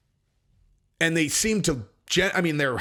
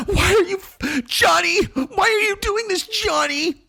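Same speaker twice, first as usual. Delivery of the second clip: very high wailing lunatic imitation; mock hysterical pleading; 13 semitones higher, 4 dB louder, slower than usual. Wife pleading with Johnny after he left, mock hysterical. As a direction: wail and plead in exaggerated falsetto. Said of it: "You sound like a lunatic".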